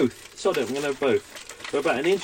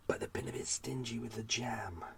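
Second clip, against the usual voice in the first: whispered; 13 dB quieter than usual. Real, not acted. Aside about stingy jam.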